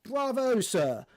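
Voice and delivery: high, loud read-out of mock praise; mock applause. Reading a forum reply to co-host, mock applause. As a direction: crow it like a sarcastic commenter.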